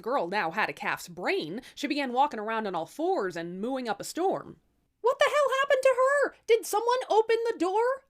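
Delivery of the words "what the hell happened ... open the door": very high, loud furious yell; outraged shout. Acted. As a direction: demand who opened the door. Tatterhood finds sister cursed.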